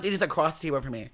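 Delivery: normal talking voice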